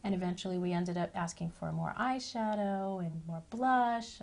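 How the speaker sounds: ordinary delivery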